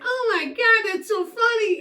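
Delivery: very high, loud mock hilarity; hammed-up squeal of amusement; imagined amused reaction. Wife showing how she would have reacted, high mock delight. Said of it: "I'd be like".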